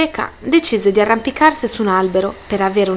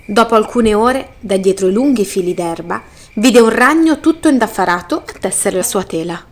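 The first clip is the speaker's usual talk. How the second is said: usual speaking voice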